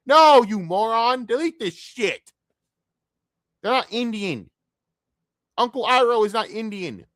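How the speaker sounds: real irritation spilling out over the movie's casting; fed-up outburst. Real, not acted. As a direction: berate the filmmakers like they're right in front of you. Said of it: "Sorry, I'm pouting. I'm pissed".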